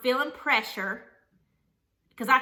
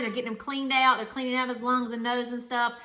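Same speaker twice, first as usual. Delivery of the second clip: ordinary talk, nothing marked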